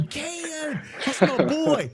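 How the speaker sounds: high, ringing yell from the stands; proud fan yell. Retold or imitated. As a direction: holler his name like a proud fan in the crowd. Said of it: "standing up on the third row going".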